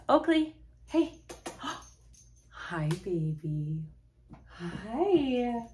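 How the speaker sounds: real doting dog voice; pet talk. Real, not acted. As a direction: coo at your puppies. Greeting her dogs on camera.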